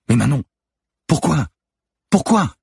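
high, loud cry of why; anguished outcry. A narrator's reading. Manon's paper thrown out of the window, anguished outcry. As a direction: cry it out, pitch leaping up.

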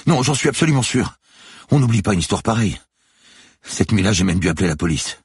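high, loud protest; emphatic insistence. A narrator's reading. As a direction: insist to the doubting officer. Guard insists he is sure. (insistance vive, voix aiguë et forte)

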